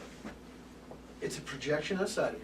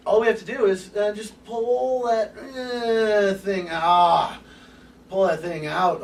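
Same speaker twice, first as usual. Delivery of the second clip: 1 mock straining; 6 semitones higher, 10 dB louder, slower than usual. Acting out pulling a block out.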